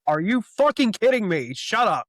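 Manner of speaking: mock outrage at a cheesy comic line; exasperated outburst. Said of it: "And I'm like".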